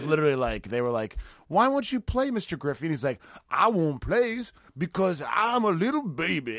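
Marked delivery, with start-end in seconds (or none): 1.5-2.9 lightly voiced high children's question; mock schoolkids asking. Voicing schoolchildren in retold sketch. 3.5-6.6 high, slow, drawn-out whine; mock big-baby reply. Acted mock-baby reply in retold sketch.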